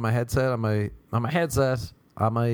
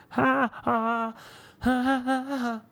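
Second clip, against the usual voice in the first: high, rhythmic "ha" moans; mock orgasm sound; 13 semitones higher, slower than usual. Comedian demonstrating his own sound, mock orgasm. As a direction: pant out high staccato ha-has. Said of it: "That's my orgasm sound".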